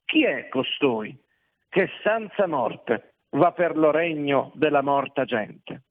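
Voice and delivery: ordinary delivery, nothing marked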